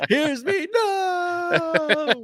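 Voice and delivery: high laughing squeal. Real, not acted. Laughing mock crying.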